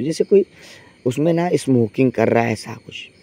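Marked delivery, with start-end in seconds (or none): 2.5-3.1 suspense whisper. A narrator's reading. Spooky detail about smoking in toilet.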